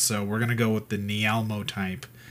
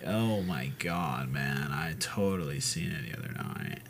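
lower, softer, dazed stoner voice; 7 semitones lower, 9 dB quieter than usual. Retold or imitated. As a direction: play a spaced-out stoner. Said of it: "You sound like a stoner".

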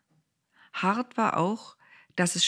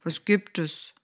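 grumbling. A narrator's reading. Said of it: "brummelte der".

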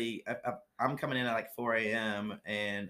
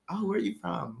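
high-pitched imitation of intrigued passengers; lifted, curious passenger voice; 8 semitones higher than usual. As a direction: ask it with mock fascination. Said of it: "everyone's like".

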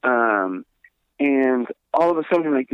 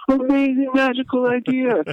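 mock amazement; 12 semitones higher than usual. Sarcastic praise of the plan.

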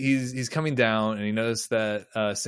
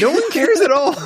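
much higher, louder, mock-outraged exclaim; mock outrage; 8 dB louder than usual. Real, not acted. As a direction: squeal it in disbelief. Mock exclaim about the crew.

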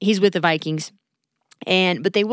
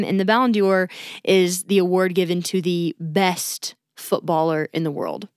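usual speaking voice

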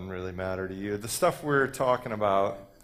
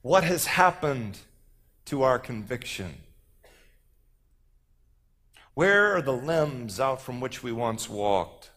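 slow, declaimed poetic lament; poem recital; 4 semitones higher, 4 dB louder, slower than usual. Acted. Speaker recites a poem asking what happened to conviction, declaiming. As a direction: stretch the key words in a rhetorical appeal.

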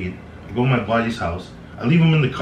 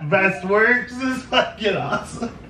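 excited; 12 semitones higher than usual. Retold or imitated. Excited retelling of happy friend.

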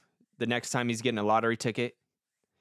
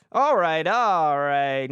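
louder, brighter reset; host pulls himself out of sadness, louder; snapping out of it; 4 semitones higher, 7 dB louder, much slower than usual. Real, not acted. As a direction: shake off the gloom and perk up. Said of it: "enough of this sadness".

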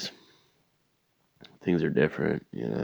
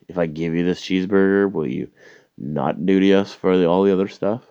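louder and higher as the bribe pitch builds; rising rant; 4 semitones higher, 6 dB louder than usual. Retold or imitated. Comic to border officer, growing louder pleading rant. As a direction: push the offer harder and higher each line.

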